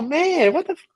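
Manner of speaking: very high, stretched-out mock complaint; outraged customer. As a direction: whine the price back slowly like a stunned customer. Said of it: "They go".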